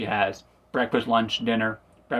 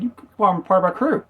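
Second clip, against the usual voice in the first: eager recruit; 8 semitones higher than usual. Retold or imitated. Luffy recruiting Usopp.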